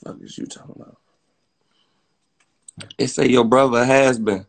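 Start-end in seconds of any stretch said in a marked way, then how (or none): 0.0-1.0 breathy whisper under his breath; whispered dismissive question. Real, not acted. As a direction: mutter it to yourself, no voice. Repeats line, second time hushed.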